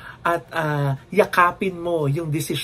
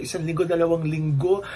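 ordinary talk, nothing marked

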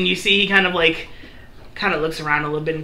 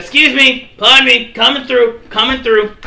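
higher, louder polite push; Fluffy pushing through; 6 semitones higher, 6 dB louder than usual. Acted. Fluffy leading the party through a crowd, loud. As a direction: call out while shoving through a crowd. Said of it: "He goes".

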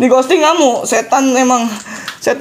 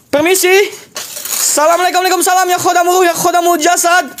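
raised ritual calling; mock incantation; 9 semitones higher than usual. Ghost hunter calling the khodam, raised ritual voice. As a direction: summon the khodam, half-chanting. Ghost-hunting challenge invocation.

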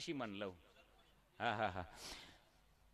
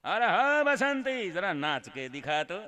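slow mock filmi villain taunt; Gabbar Singh impression; 3 semitones higher, 8 dB quieter, slower than usual. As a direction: ham up a Sholay villain, lightly voiced. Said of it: "ગબ્બરનો role લીધો".

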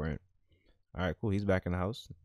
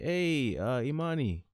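voicing him higher and shy; awkward greeting, voiced higher; high nervous character voice; 6 semitones higher, slower than usual. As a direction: pitch up like a nervous guy. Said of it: "He's like".

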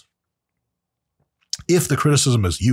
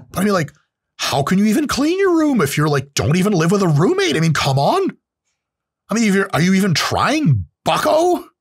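higher, pressing lecturer's voice that peaks on bucko; Jordan Peterson impression; 8 semitones higher than usual. Jordan Peterson scolding someone who won't clean their room, higher voice. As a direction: hector the viewer like a stern lecturer. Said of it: "my Jordan Peterson impression".

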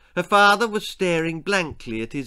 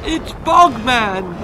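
high, loud, drawn-out warning cry; shouting; 6 semitones higher, 6 dB louder, slower than usual. A narrator's reading. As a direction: shout the alarm in a high girl's voice. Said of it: "shouted Helen".

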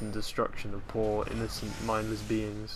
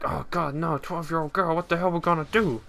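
mock panic; 6 semitones higher, 3 dB louder than usual. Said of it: "they're like".

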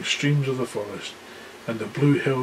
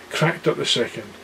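usual speaking voice

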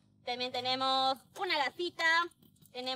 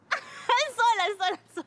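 laughing teasing; 6 semitones higher, 5 dB louder than usual. Acted.

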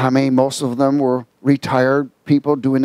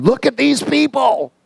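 high, slow mock outrage; a mocking high take on the outraged reporter; 11 semitones higher than usual. Reporter pointing at the crowd, high and mocking.

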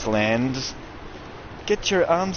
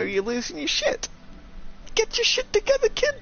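high shrill scolding. Acted. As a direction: scold the kid from above. Scolding himself in voice.

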